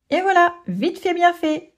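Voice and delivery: high, smug little boast; self-satisfied. Acted. Zori proud of his stick trick, a high smug boast. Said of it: "se félicite-t-il".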